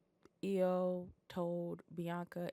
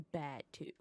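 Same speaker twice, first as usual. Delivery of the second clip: usual speaking voice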